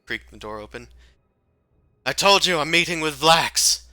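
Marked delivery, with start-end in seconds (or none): 2.0-3.8 high, loud indignant retort; exasperated outburst. Acted. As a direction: snap back at the intruder. Character snaps at being disturbed.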